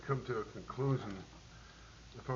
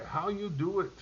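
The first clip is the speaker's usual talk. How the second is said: put-on higher, German-accented amazed question; mock German question; 7 semitones higher, 3 dB louder than usual. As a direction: ask it like an astonished German soldier. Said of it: "They say".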